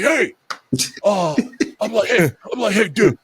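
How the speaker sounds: retold boozy hailing, half laughing; fired-up drunk. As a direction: act out tipsily pestering for attention. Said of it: "fired up. I'm fired up".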